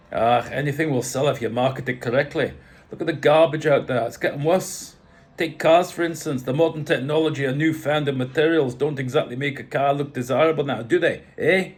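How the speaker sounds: loud, commanding Scottish father; rough Scottish accent. A narrator's reading. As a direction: size up the newcomer as a blunt Scot. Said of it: "Scottish, slightly rough".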